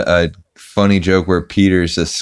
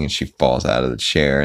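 plain reading voice

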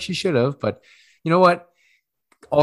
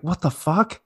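pitched-up imitation of a stunned reaction; mock shock; 9 semitones higher than usual. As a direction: act out a startled what-the. Retold shocked reaction to crying.

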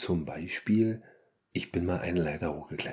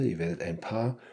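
ordinary talk

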